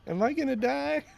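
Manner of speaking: high, squeaky, panicked little voice; mock scared squeak. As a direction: play her terrified, voice jumping way up. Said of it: "she had this look like".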